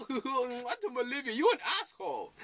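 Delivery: put-on high-pitched failed accent, laughing; botched British accent. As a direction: laugh out a badly faked British mom.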